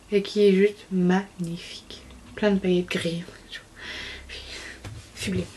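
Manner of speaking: hushed, breathy admiration of the polish; awed whisper. Real, not acted. Vlogger to viewers admiring glittery grey polish, whispering. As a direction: whisper it like it's too beautiful to say aloud.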